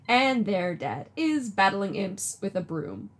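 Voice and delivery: ordinary talk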